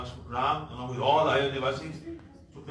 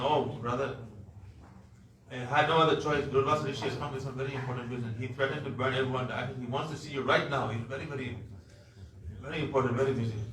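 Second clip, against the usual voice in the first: ordinary delivery, nothing marked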